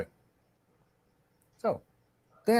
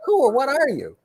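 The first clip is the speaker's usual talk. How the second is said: higher-pitched, playfully retold disbelief; mock astonishment; 9 semitones higher than usual. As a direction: imitate a baffled guest, high-pitched. Retold guest baffled by host's cupboard.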